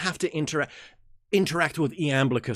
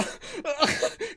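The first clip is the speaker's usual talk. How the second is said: high giggle. Real, not acted. Laughing at own bit.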